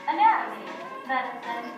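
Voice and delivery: ordinary delivery, nothing marked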